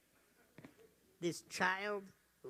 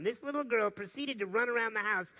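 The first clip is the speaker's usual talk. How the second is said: ordinary talk, nothing marked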